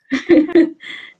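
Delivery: short, light chuckle; amused laugh. Real, not acted. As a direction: laugh briefly at your own remark. Laughs at her own joke. (risada curta e divertida)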